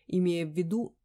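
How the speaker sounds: ordinary delivery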